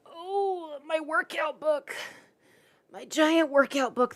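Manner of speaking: high, slow mock whine; hammed-up cry over the heavy book; mock wail. Mock-crying about her giant workout book.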